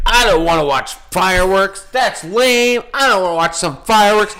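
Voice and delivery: high whiny mock complaint; mock his friend in a high whine. Mocking her friend refusing the fireworks, high whine. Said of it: "And he's like".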